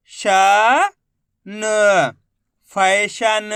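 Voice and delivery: slow, separated sounds, then firm "पैडल", "पैदल", "फैशन"; letter-by-letter sounding out. Shown as a demonstration. Teacher drilling spellings for learners, syllable by syllable. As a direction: stretch each letter, then blend "फैशन" firmly. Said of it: "एक अक्षर का उच्चारण करके इनको पढ़".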